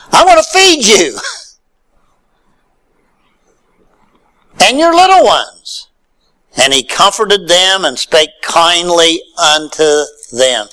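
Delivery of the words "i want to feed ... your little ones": lightly voiced Joseph, pitched well up; a hint of Joseph eagerly promising food; Joseph offering kindness. Joseph promising to feed his brothers' children, pitched high.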